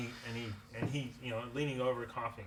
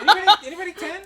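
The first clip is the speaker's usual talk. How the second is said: laughing shout; 17 dB louder, much faster than usual. Acted. Seller hawks apple, laughing.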